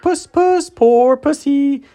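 very high, slow, sneering coo; mocking baby talk. A narrator's reading. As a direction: coo at him as if at a kitten, cruelly. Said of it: "saying things like".

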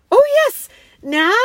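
high, loud eager burst; excited girl. A narrator's reading. Lucy jumping up for the lion's news, excited.

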